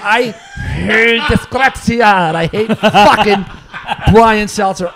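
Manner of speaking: slow, drawn-out shouted loathing; exasperated hate. Real, not acted. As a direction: stretch the words out in comic fury. Mid-rant about hated musician.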